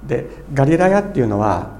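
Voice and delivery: usual speaking voice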